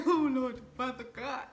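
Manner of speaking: loud, dramatic cry to heaven; hammed-up shock, high and loud; overwhelmed exclamation. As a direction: invoke God in mock horror. Retold shock, calling on God.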